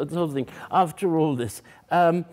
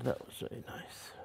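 whispered praise; 6 semitones lower, 16 dB quieter than usual. Real, not acted. Teacher praising softly after playing.

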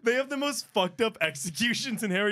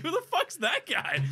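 mock baffled; 11 semitones higher than usual. Retold reaction.

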